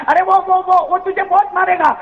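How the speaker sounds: high, alarmed mother stammering; mother warning her son, high and afraid; frightened warning. Acted. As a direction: stammer out the danger in fear.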